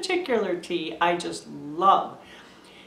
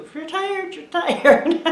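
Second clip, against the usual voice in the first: laughing it off; 10 semitones higher, 6 dB louder than usual. Real, not acted.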